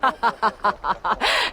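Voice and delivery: short mocking laugh at a beaten foe. Villain laughs at the hero she just beat, mocking laugh. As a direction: laugh down at him with scorn. Sinmara mocks defeated foe.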